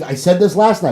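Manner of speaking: ordinary delivery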